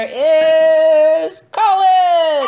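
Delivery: loud, slow, sing-song winner announcement; game show announcer. Acted.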